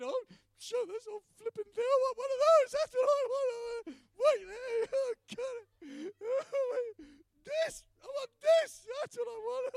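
very high, loud wailing shout; bawling yell. Retold or imitated. Man showing God what he wants, bawling and yelling. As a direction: act out a tearful tantrum at full voice. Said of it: "bawling my eyes, yelling at God".

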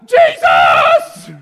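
a huge, high bellow of Jesus; full yell. Acted. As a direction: scream the name across the crowd. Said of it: "he yells all the louder".

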